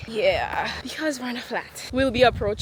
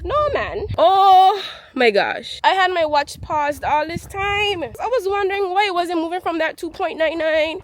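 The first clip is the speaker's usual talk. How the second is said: loud dismayed oh my gosh; frustrated surprise; 7 semitones higher, 7 dB louder than usual. Real, not acted. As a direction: realize your mistake out loud. Discovers watch was paused.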